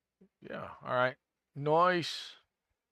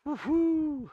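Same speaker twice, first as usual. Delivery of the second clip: excited whoop; 4 dB louder than usual. Real, not acted. Files recovered.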